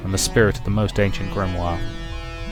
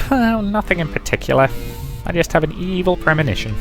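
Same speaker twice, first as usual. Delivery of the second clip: much higher, airy and offhand; 9 semitones higher than usual. Acted. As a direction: brush it off in a high voice. Character answers airily.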